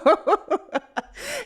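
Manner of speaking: squealing laugh; high laugh. Real, not acted. Laughing at own joke.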